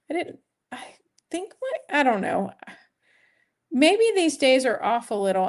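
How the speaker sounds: normal talking voice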